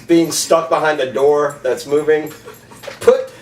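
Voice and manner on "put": high mock German accent; hammed-up German accent again, pitched up; playful fake German accent. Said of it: "That's my German accent".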